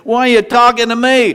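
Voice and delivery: imitating a grizzled sergeant's rebuke; gunny sergeant. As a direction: challenge them like a tough gunny. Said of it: "That's like a gunny".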